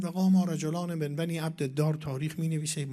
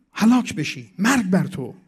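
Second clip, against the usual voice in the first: loud, high cursing in an opponent's role; retold shouted curse; 4 semitones higher, 9 dB louder than usual. Preacher translating the opponent's curse on the Prophet, shouted. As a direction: hurl each short curse out hard. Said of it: "کی داد زد و فریاد زد علیه پیامبر".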